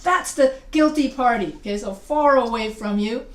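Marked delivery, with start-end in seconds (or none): 0.0-1.5 loud pointed accusation; loud mock courtroom finger-pointing; acted accusation. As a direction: call out the culprit, punching "that's". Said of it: "and you're pointing".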